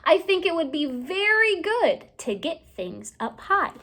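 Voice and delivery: ordinary delivery, nothing marked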